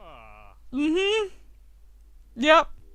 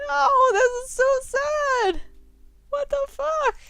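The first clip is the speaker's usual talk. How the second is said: much higher, slow, anguished wail; shocked wail; 12 semitones higher than usual. Real, not acted. Gamer reacting to Brian's sad confession, shocked wail. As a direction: cry out at the sad reveal.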